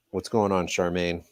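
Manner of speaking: ordinary delivery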